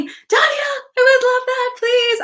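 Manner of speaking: high, crying-out plea to a fan; excited squeal. Real, not acted. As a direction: squeal the name, then beg happily. Live chat, excited reply to fan.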